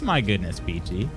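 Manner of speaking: usual speaking voice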